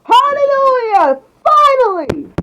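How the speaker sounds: whole class cheering, high and loud; very high, loud whoop; jubilant shout. A narrator's reading. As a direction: shout it out in relieved celebration. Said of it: "Everyone shout and say".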